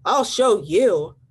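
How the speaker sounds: loud, slow, huffy threat; grouchy ladybug. Acted. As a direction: puff it out like a boastful bug. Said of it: "said the grouchy ladybug".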